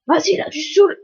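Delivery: loud exasperated snap at the game; you are fed up, say it loud; annoyed outburst. Real, not acted. Player fed up with the game, loud annoyed outburst.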